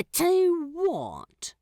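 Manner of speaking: a prim angel, caught out and flustered; Aziraphale voice. A narrator's reading. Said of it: "He breaks off with a soft gasp".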